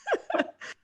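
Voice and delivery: high-pitched real laugh. Real, not acted. Laughing at friend's college-age pact, high and amused. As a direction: crack up in a high voice.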